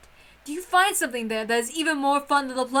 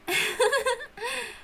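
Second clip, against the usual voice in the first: light laugh; 11 semitones higher than usual. Acted. Maid laughs at tea party.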